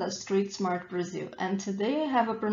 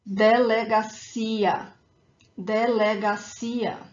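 slow, careful delegacia, de-le-ga-CI-a; stress on final ia; 4 dB louder, slower than usual. Shown as a demonstration. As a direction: model delegacia with a strong ia. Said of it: "is that ia at the end".

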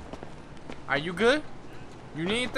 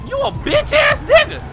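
taunt; 12 semitones higher, 4 dB louder than usual. Real, not acted. Taunting a rival in game.

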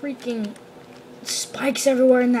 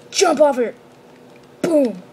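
ordinary talk, nothing marked

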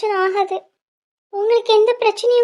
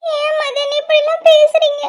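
distraught plea; 9 semitones higher, 5 dB louder, slower than usual. Acted. Lover threatens suicide.

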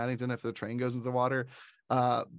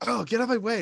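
high, irritated retold outburst; mock angry; 9 semitones higher, 4 dB louder, faster than usual. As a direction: imitate a cross woman shooing someone. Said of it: "I'm very angry".

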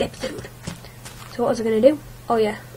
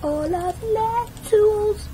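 drawn out, much higher; sing-song; 9 semitones higher, much slower than usual. Pretend. To the viewers, playfully hamming up the tools line. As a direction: stretch it up high and slow.

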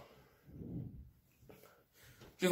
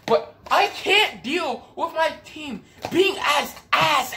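frustrated shout; 11 semitones higher, slower than usual. Real, not acted. Ranting about his team.

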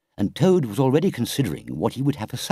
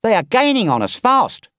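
alarmed driver, higher and louder; crying out in alarm; 10 semitones higher, 6 dB louder than usual. Acted. Engine driver warning Toad of the pursuers, alarmed and loud. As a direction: sound the alarm about the chasers. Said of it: "cried the engine driver".

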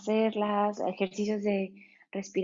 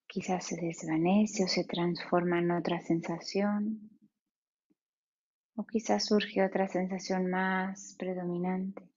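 guided meditation. Acted. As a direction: ease them into stillness, unhurried.

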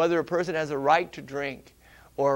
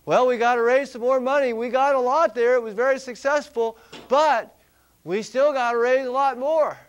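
imitating a pushy fundraiser, higher and louder; mock church organizer, high and loud; eager fundraiser; 8 semitones higher, 9 dB louder than usual. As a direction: ham up the committee chair begging for more money. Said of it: "No, they'll say".

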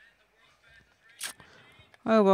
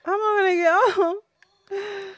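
higher, slow, fake-crying whine; mock wail; 9 semitones higher, slower than usual. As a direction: ham up the heartbreak like a sobbing kid. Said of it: "that draw has really upset me".

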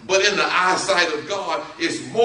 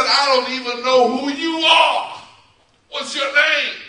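loud, high-pitched protest; exasperated Moses; 7 semitones higher, 4 dB louder than usual. Acted. Moses demanding the unknown voice's name, exasperated. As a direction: push it up high in indignant disbelief.

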